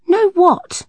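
put-on high, sharp little girl's question; puzzled child. A narrator's reading. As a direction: ask it bewildered. Saffron asks puzzled.

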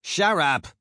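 put-on "Shut up!" as "shaddup", loud and high in a Polish Brooklyn accent. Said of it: "in her Polish Brooklyn accent".

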